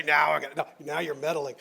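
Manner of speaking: low, loud mock grumble; a hammed-up listener grumbling at the preacher's meddling; grumbling mock protest. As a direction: play an offended churchgoer complaining. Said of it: "you're gonna say".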